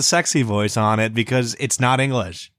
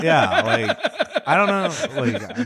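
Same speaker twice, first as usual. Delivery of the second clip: laughing; 7 semitones higher, slower than usual. Real, not acted. Laughing after the outburst.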